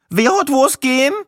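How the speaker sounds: voiced Viennese woman.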